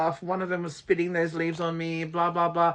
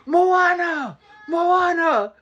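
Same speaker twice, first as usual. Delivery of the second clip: high, loud cry for dad; imitated screaming; 11 semitones higher, 6 dB louder, much slower than usual. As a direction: imitate a panicked scream for help. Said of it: "he started screaming".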